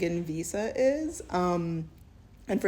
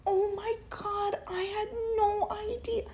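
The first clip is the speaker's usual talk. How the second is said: much higher, gasping and teary, hammed-up; overplayed shock, voice way up; mock shocked woman. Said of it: "It wouldn't necessarily be a".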